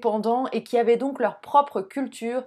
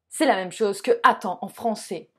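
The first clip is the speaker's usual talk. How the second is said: ordinary delivery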